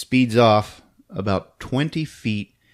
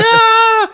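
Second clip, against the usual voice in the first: very high, loud mock shriek; hammed-up cry of pain; pain scream; 7 dB louder than usual. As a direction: scream as if just stabbed. Stabbed driver cries out.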